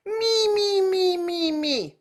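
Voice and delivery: high nasal me calls. Shown as a demonstration. Teacher modelling the nasal me drill, high. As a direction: ring me through the nose.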